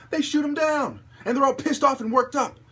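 high, loud indignant rant; angry outburst. Real, not acted. Railing at cops who shoot fleeing suspects, loud and high. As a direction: spit it out in outrage.

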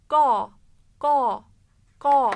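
open-mouthed go, between o and a; open o sound. Shown as a demonstration. Teacher drills the open vowel for the student. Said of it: "ağzımı A der gibi açarken O sesi veriyorum".

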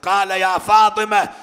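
loud, high, grieving sermon chant; mourning lament preaching. Acted. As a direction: wail the story to the mourners. Lament recitation over music. (نعي حسيني بصوت عالٍ ونبرة باكية)

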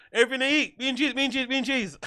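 mock-chant "Everything to eat", pitched well above normal; imitated sing-song inflection. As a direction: mimic a high, repetitive inflection on "bean cheese". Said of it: "he's got that same way".

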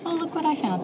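ordinary talk

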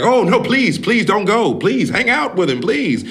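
high, urgent mock begging; pleading. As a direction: imitate eager parents begging her to stay. Parents beg the girl to stay.

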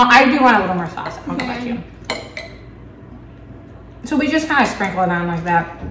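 ordinary talk, nothing marked

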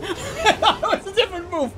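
high, laughing through the words; real helpless laughter over the fight; laughing hard. Real, not acted. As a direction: crack up while explaining. Losing it laughing.

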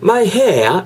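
hair with no R sound, British style, hammed up; overdone British-accent my hair. Shown as a demonstration. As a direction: exaggerate a British my hair, dropping the R. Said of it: "이건 좀 오버한 겁니다".

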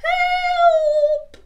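very high, loud scared shout; cry for help. Acted. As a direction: yell it as if terrified.

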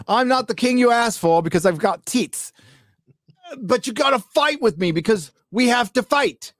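high mock English accent, hammed up; bad English accent. Mocking Bronwyn's rallying speech in a bad English accent. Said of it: "That's a bad English accent".

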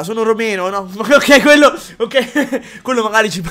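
usual speaking voice

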